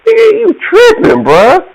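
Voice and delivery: very high, squeaky disbelief; imitated cousin's high disbelief; incredulous cousin. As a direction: squeal it as if you can't believe it.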